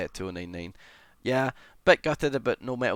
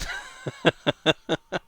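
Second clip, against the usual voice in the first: bursting giggle, higher; helpless giggling; 5 semitones higher, 5 dB louder than usual. Real, not acted. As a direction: let a giggle out. Laughing at chat.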